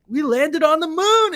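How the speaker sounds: excited quote. Retold or imitated. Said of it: "and he gets excited".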